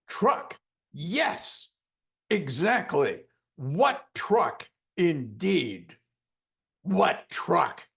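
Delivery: loud, high, commanding trance talk; commanding hypnotist. Retold or imitated. Hypnotist commanding the angry client into sleep, loud and high. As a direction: take charge and command him. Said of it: "I said these words".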